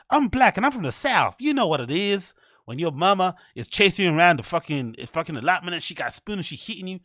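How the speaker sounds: mock the cliché comic voice.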